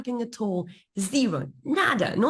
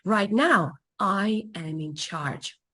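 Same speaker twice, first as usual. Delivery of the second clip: ordinary talk, nothing marked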